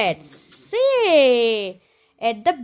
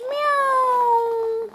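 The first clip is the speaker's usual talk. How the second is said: high, cute little meow; kitten meowing; 8 semitones higher than usual. Pretend. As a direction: mew like a kitten for the child. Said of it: "Kitten क्यों बोला बेटा?".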